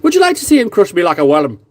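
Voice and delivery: half-hearted Scottish jeer; taunting in a mock Scottish voice; Scottish accent. Quoting Braveheart, a light mock Scottish taunt. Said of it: "too many good lines in Braveheart".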